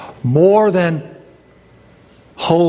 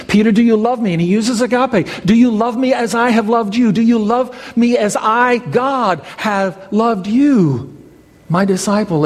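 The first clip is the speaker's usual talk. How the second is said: impassioned plea; 8 semitones higher than usual. Acted. Jesus asking Peter, voiced intensely.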